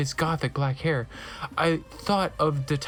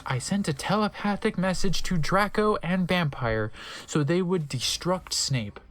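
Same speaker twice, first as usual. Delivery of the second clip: ordinary talk, nothing marked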